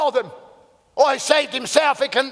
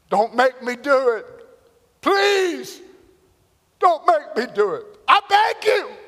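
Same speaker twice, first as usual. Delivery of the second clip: rising, high acted begging; desperate, climbing entreaty; crying plea; 4 semitones higher than usual. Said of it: "is crying".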